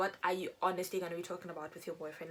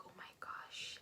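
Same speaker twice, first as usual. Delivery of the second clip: breathless whispered gasp of shock; 12 dB quieter, slower than usual. Retold or imitated. Girl hearing mom scold her boyfriend, shocked whisper. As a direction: whisper it, caught out.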